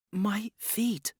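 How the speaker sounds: breathless, high whisper of shame. A narrator's reading. Zhang confessing her bound feet to Jun, whispered. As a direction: barely breathe the words out, embarrassed.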